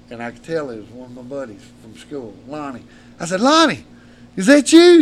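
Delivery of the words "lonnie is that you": high, loud surprised call. Retold or imitated. Bloodied man recognizes his school buddy, calling high and loud. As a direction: shout out in disbelief.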